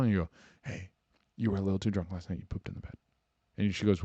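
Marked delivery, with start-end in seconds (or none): 0.6-2.9 hushed, quick, soft near-whisper; gentle waking. Acted. Boyfriend gently tells hungover girlfriend what happened, hushed. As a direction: break it to them very softly. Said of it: "You don't wake her up and go".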